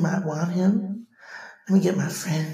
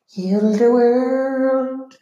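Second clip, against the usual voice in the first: high loud slow plea; pleading; 5 semitones higher, 7 dB louder, slower than usual. Pretend. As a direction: belt out the plea. [name] plea.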